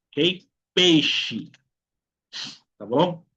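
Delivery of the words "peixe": "Peixe" said "pêi-shi". Shown as a demonstration. Teacher giving an example word, "Peixe" with a sh sound. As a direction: hush the X in "Peixe". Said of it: "el sonido del silencio".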